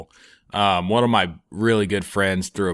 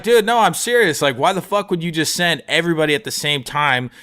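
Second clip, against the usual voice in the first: heated protest; 7 semitones higher than usual. Retold or imitated. Arguing with the cop.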